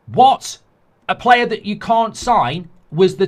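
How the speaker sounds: sharp, high, loud disbelieving what; outraged what. Pretend. Fan grilling the club, explodes in loud high disbelief.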